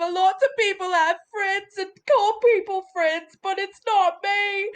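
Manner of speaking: a lost ogre pleading through tears for his own name; ogre starting to cry. Acted. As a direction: voice climbing high, breaking into tears. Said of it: "start to cry".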